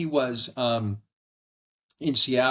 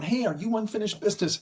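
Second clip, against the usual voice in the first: high, eager greeting; mock excited stranger; imitating a passerby; 9 semitones higher, faster than usual. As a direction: call out like a fan spotting someone. Passersby calling out.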